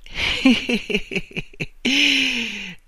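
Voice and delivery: high cackling burst of laughter; sudden laugh. A narrator's reading. Fallen man suddenly bursts out laughing at Marta. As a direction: break into high, staccato laughter. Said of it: "improvvisamente lui si mise a ridere".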